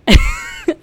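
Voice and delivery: a high burst of squealing laughter; excited squeal. Real, not acted. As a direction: shriek with laughter at the luck. Squeals at third line going off.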